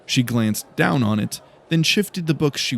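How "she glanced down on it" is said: plain reading voice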